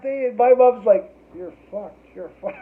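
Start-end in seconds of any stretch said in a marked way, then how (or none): 1.3-2.6 soft, hushed warning from mom. Retold or imitated. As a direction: lean in and murmur the doom. Mom whispering in court.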